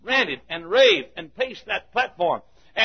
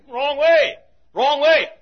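higher, louder warning call; yelled warning; 8 semitones higher, 6 dB louder, slower than usual. Retold or imitated. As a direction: call out to the runner he's going the wrong way.

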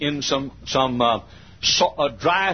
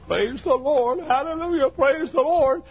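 joyful praise; 13 semitones higher than usual. Retold or imitated. Mother dancing, praising God.

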